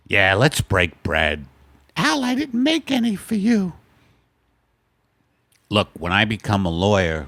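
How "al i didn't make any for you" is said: higher falsetto wife teasing. Acted. Wife teasing her husband Al, in a higher falsetto. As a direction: tease him in a high wife voice. Sketch, Margaret character voice.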